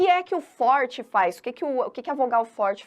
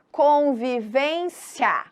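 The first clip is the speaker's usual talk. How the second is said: drawn-out syllables; 3 semitones higher, much slower than usual. Shown as a demonstration. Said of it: "Você percebe que aqui nós temos".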